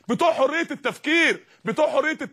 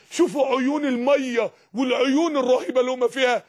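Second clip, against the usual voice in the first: ordinary talk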